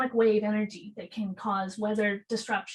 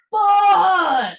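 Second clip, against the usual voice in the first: a sudden, much higher, much louder "Bah!" roar; mock primal roar; 9 semitones higher, 12 dB louder than usual. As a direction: blast the "Bah!" out like energy bursting to the sky. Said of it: "this massive sound comes out of me".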